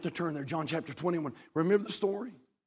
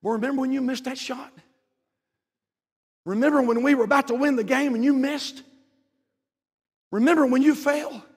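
mock nagging reminder; 10 semitones higher, 5 dB louder than usual. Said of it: "we're still reminding them".

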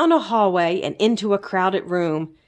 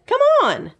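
high, bright-eyed little critter voice; high eager sidekick calling a friend; Zeke the little creature; 14 semitones higher, 3 dB louder than usual. A narrator's reading. Zeke's voice in the story.

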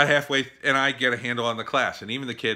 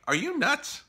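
pitched way up in disbelief; incredulous. Retold or imitated. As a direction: squeak it out, can't believe what you hear. Staring at assistant principal in disbelief.

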